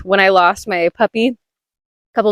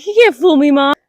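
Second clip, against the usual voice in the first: dog's voice; 5 semitones higher than usual. Retold or imitated. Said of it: "He's like".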